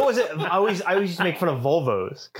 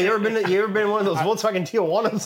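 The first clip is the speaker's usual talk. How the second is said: high, fast mocking voice; mock clueless friend; 7 semitones higher, faster than usual. Mocking the friend's Tijuana mix-up, high and fast. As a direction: rattle it off like a clueless buddy. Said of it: "He's like".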